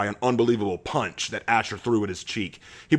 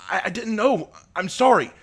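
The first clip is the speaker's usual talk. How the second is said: higher, faltering sorry; stunned apology; 11 semitones higher than usual. Acted. Abraham stunned, apologizing to Asher, faltering. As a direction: stammer out an apology.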